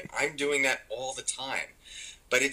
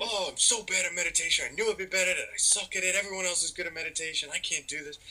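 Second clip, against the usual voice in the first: higher, whiny, self-scolding inner voice; self-critical inner voice; 8 semitones higher, faster than usual. Retold or imitated. Meditator scolding themselves, a higher self-critical whine. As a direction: moan at yourself for failing at meditation. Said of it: "And then we go".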